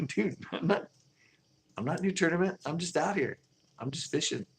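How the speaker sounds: mock exasperated angler defending himself; defensive protest. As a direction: protest innocently, voice up high. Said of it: "And I'm like".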